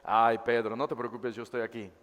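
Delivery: low, soft reassurance; gentle comfort. Shown as a demonstration. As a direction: soothe him tenderly. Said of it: "Sino le dice". (consuelo suave y bajo)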